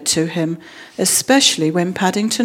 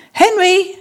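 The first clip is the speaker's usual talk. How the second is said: high, louder call of a name; sharp exclamation; 11 semitones higher, 5 dB louder than usual. A narrator's reading. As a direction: call out to rouse a dozing husband. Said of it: "she exclaimed".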